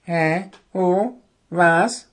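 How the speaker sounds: short, dazed, high questions; dreamy owl. A narrator's reading. Owl roused from sleep asks what's happening, dreamy. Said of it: "fragte der Kauz verträumt".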